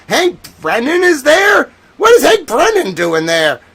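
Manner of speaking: high, loud shout of disbelief; surprised shout. Real, not acted. Host spotting Brennan on the hearing feed, shocked shout. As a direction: blurt the name out, stunned.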